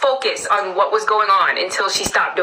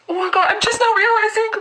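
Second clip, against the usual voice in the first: high-pitched dramatic realization; dawning horror; 11 semitones higher than usual. Acted. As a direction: let the voice climb as it sinks in. Mock horror at sudden realization.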